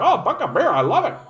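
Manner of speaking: mocking Ford's fans raving about cheap beer, hammed-up glee; gushing dopey voter. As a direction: gush like a thrilled simpleton who loves cheap beer. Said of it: "And they're like".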